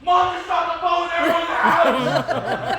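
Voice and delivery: loud, high, slow, laughing outburst; laughing excited kid. Retold or imitated. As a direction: blurt it out, cracking up. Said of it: "I'm like".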